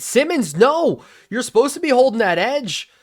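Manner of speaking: loud, high-pitched protest; exasperated cry at the screen; real frustrated scolding. Real, not acted. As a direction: yell at your player for blowing it. Scolding a player after blown play.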